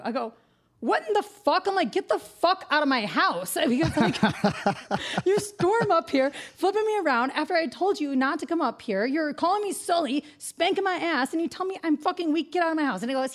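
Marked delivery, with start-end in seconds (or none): 0.8-1.9 high, indignant retort; outraged. Retold or imitated. Girlfriend reacting to being called weak, high outrage. 1.9-3.6 raised, drawn-out angry order; outraged. Retold or imitated. Girlfriend throwing drunk boyfriend out of her house, angry. 5.3-13.0 raised pitch, heated scolding; indignant tirade. Retold or imitated. Girlfriend telling off drunk boyfriend who barged in, indignant.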